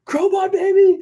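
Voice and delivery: very high, loud hype yell; excited fan shout. Retold or imitated. Fan on Fremont Street yelling at his shirt, very high hype shout. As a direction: belt it like a fan spotting the band shirt.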